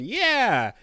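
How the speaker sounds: high, loud "yeah"; mock hyped cheer. Host mimicking Hi-Fi Rush in the merger trailer, mock hyped. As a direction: cheer like a hyped game character. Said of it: "Hi-Fi Rush in there going".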